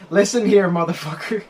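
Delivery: hammed-up angry rant at a friend; mock angry rant. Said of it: "And then she goes".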